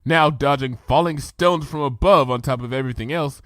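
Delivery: ordinary delivery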